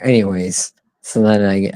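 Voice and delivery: ordinary talk, nothing marked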